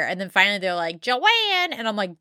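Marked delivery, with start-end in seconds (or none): 1.0-1.7 high, drawn-out waiting-room call; calling out a name. Retold or imitated. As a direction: imitate staff calling the next patient. Staff calling patient from waiting room.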